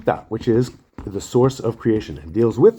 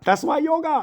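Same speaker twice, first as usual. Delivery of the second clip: squeaky punchline. Acted. Punchline shouted high.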